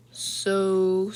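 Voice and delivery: long, drawn-out 'So'; slow, hesitant opener; slow realization. A narrator's reading. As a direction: stretch the word as it dawns on you. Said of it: "Sarah said slowly".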